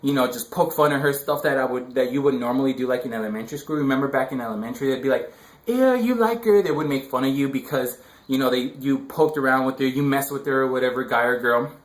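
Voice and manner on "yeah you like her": put-on teasing kid. Kids teasing in elementary school.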